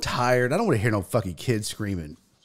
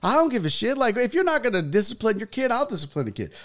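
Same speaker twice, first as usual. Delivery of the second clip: defiant; 9 semitones higher, faster than usual. Retold or imitated. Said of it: "I was like".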